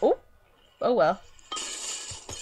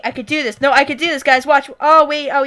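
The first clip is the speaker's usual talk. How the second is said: ordinary talk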